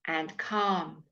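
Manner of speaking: calm as kahm, no l; silent l calm. Shown as a demonstration. Teacher testing students on silent l. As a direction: drop the l in calm. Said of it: "Which letter is silent?".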